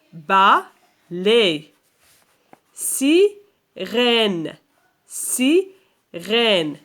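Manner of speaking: slow dictation, a pause between syllables. Shown as a demonstration. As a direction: break each word into separate, drawn-out syllables. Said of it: "puis écris le nombre de syllabes".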